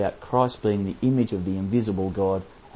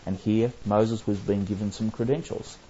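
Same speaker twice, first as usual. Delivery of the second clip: usual speaking voice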